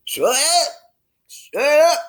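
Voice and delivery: high and loud, rising angry yell; Harry yelling, ignoring the ice warnings. A narrator's reading. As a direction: shout the warnings away. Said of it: "Harry yells".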